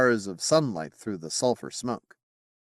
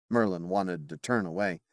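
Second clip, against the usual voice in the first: usual speaking voice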